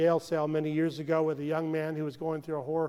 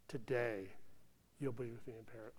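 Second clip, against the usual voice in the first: gentle Jesus; 6 semitones lower, 13 dB quieter, faster than usual. Acted. Jesus answers the thief on the cross.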